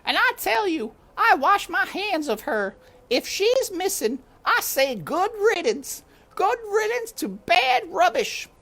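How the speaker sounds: high, loud shrill-voiced maid yelling; high loud maid's shout; shouting servant woman. A narrator's reading. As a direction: bellow it like a fed-up servant. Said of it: "Lewis was shouting".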